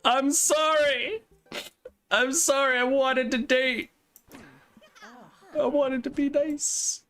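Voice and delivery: very high, slow put-on wail; mock pleading apology. Player apologising for an awkward flirt, mock high pleading. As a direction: drag out a hammed-up high-pitched plea.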